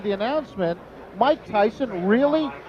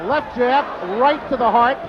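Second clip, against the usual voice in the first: play-by-play. Real, not acted. Live call of the knockdown.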